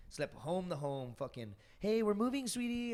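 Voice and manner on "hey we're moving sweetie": high, sing-song mock mom; lightly put-on sweet mother voice; mom's sweet voice. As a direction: mimic a mother cooing to her kid. Mother voiced in childhood story.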